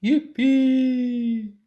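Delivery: loud put-on storybook cheer; gleeful, drawn-out yell; cried out. A narrator's reading. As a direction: whoop it in character. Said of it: "the tees cried out".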